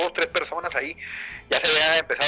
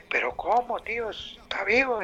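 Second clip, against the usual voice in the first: amigo sorprendido; 6 semitones higher, 4 dB quieter than usual. Retold or imitated. Rescatistas sorprendidos arriba.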